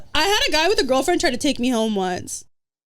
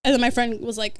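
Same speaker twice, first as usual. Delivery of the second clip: normal talking voice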